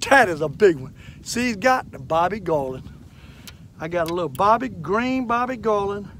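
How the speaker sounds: loud, thrilled fisherman showing off a catch; excited. Real, not acted. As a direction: shout it out, can't contain the joy. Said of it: "Old fisherman gets excited with them crappies".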